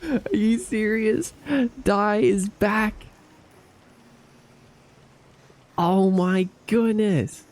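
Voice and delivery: high, slow, amazed; thrilled disbelief. Real, not acted. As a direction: stretch it out, overjoyed. Thrilled at returning gifter.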